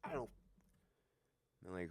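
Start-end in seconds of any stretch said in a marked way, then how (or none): 0.0-0.3 barely voiced, very soft mock mouthing; retold mouthing. Answering a friend across from another cop car, near-silent whisper. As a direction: mouth it with almost no voice. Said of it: "mouthing stuff to me".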